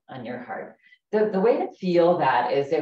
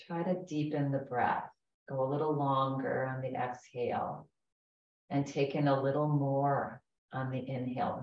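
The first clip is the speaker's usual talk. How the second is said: guided meditation. Acted. Leads a guided breathing meditation.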